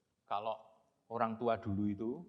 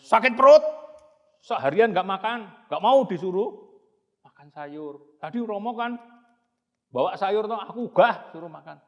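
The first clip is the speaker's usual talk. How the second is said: put-on high, loud childish complaining; whiny complaint; 7 semitones higher, 13 dB louder than usual. Bagong complaining to Romo about his stomach ache, high and loud. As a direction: complain like a hungry kid.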